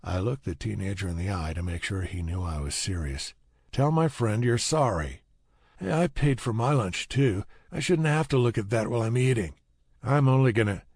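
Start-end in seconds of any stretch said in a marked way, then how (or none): 3.7-5.2 louder, higher, firm demand for an apology; stern demand. A narrator's reading. Narrator confronts rude teenager for friend. 5.8-10.8 higher, louder self-justifying complaint; indignant protest. A narrator's reading. Teenager indignantly defends his insult.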